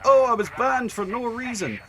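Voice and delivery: loud, high-pitched imitation of a protesting player; mock protest. Streamer imitating banned players who claim innocence, mock protest.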